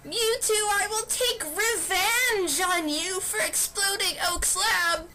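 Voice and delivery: put-on high-pitched young heroine swearing revenge; vengeful vow. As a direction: spit out the threat like a wronged kid. Sarah vows revenge on Mewtwo.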